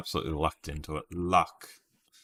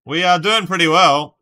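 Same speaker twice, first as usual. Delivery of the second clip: loud, much higher burst of joy; excited cheer; 10 semitones higher, 16 dB louder than usual. Real, not acted. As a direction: shout it out, thrilled with the score. Cheering on a guessing game.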